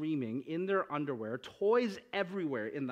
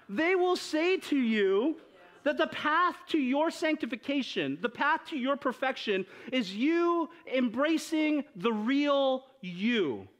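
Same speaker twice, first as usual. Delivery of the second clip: mock inspirational; 7 semitones higher, 3 dB louder than usual. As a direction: parody a cheesy motivational promise. Mocking Disney's self-help message.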